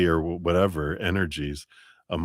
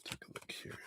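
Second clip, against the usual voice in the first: whisper; 25 dB quieter, faster than usual. Real, not acted. Reader repeats it to herself.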